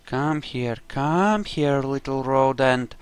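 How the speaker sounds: usual speaking voice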